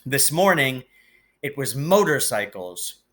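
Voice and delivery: usual speaking voice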